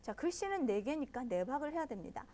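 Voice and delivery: usual speaking voice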